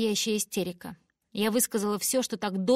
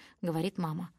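ordinary delivery

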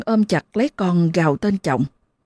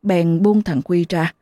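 ordinary delivery

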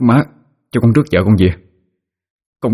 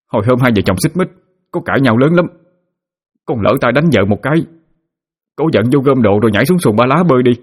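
higher, rushed frantic husband; panicked pleading; 5 semitones higher, faster than usual. Acted. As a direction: fake panic, voice climbing and hurrying. Husband fakes panic to mother.

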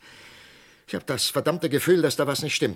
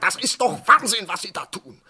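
loud, higher, desperate demand; desperate anger; 8 semitones higher, 5 dB louder than usual. Acted. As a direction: shout him down to stop the execution. Arzt will die Hinrichtung aufhalten. (verzweifelt laut und höher fordernd)

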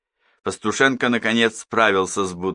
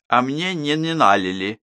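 put-on pouting child; 6 semitones higher than usual. A narrator's reading. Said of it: "надула губы девочка".

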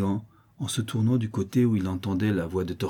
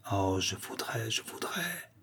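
very soft, strained longing; hushed, vengeful yearning; hushed seething; 2 semitones higher, 7 dB quieter, slower than usual. A narrator's reading. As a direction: let the wish escape almost under your breath. Brigand longs for revenge.

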